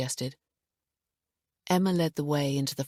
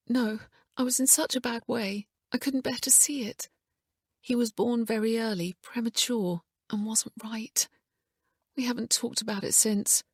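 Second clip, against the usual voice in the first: grieving; 8 semitones higher than usual. A narrator's reading. Emma grieving, retelling birth.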